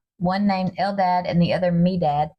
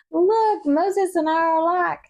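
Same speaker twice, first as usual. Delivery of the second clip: mock exclaiming; 9 semitones higher than usual. To the listeners, comparing herself to Moses, mock exclaiming. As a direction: squeal it out like a playful confession. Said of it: "I'm like".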